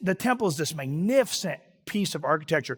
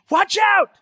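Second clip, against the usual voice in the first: sharp, high, loud cry of alarm; warning shout; 13 semitones higher, 8 dB louder than usual. Pretend. Pastor demonstrating a familiar warning term, shouted. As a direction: yell to alert someone.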